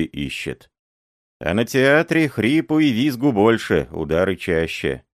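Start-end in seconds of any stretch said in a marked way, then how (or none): none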